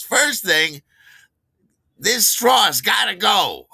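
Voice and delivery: slow, loud, high declaration of doom; mock shout. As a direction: bellow it like a solemn decree. Kathy's funny first demand.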